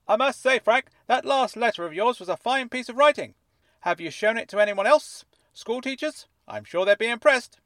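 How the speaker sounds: high, fuzzy voice like it's underwater; fuzzy fish tank voice. A narrator's reading. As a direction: speak as if from inside a fish tank. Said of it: "as though he were speaking from the inside of a fish tank".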